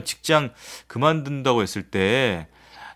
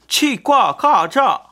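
high, very slow, louder; drawn-out high silly answer; 10 semitones higher, 5 dB louder, much slower than usual. Acted. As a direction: sing 치과과자 out high and slow. Quiz answer read out again.

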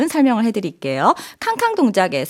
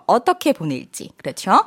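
ordinary delivery

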